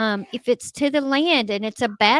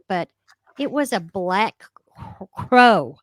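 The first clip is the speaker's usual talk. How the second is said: ordinary delivery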